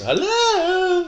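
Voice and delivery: high, eerie hammed-up ghost hello; spooky drawn-out ghostly greeting. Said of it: "Put on your ghost voice".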